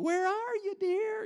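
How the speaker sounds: put-on high sing-song parent calling to a hiding toddler; playful parent. Parent pretending to hunt for a hiding two-year-old, high and sing-song. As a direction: call out sweetly like you can't see the child. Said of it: "you're playing the game".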